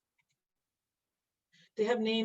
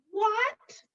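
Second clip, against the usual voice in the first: high, loud mock-astonished "what?"; hammed-up disbelief; mock surprise; 12 semitones higher, 5 dB louder than usual. Real, not acted. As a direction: act amazed at your own drawing. Surprised by own drawing.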